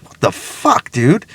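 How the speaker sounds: amazed disbelief. Retold or imitated. Speaker marvelling that plants are living, hushed amazement.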